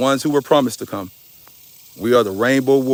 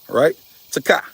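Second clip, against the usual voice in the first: ordinary delivery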